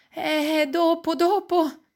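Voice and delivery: high, drawn-out brush-off; papà che taglia corto. A narrator's reading. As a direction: put the neighbour off, pitch up and stretched. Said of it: "dice papà". (voce acuta e trascinata per rimandare)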